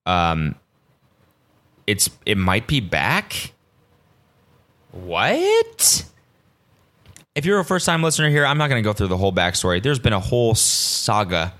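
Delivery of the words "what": high-pitched, incredulous squeak; mock surprise. Podcaster reacting to their own Taco Tuesday update, mock surprise. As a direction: feign shock at the big news.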